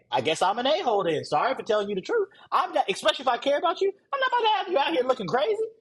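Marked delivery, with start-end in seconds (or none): 2.5-5.7 fast, much higher, fired up; fired-up insistence. Real, not acted. Coach insisting on honesty to audience, fast fired-up pitch. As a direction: push the point with heat. Insisting he'll tell truth.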